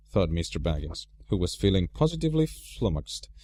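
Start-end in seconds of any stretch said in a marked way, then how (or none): none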